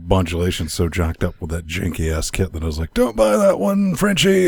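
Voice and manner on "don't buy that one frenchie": high-pitched mock plea, stretched out; retold warning. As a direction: re-enact warning a friend, pitched way up.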